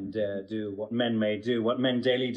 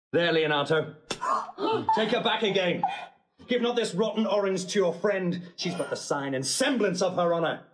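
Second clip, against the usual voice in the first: furious rejection; 8 semitones higher than usual. Acted. Groom rejecting his bride before her father, furious raised voice. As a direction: fling her back in disgust.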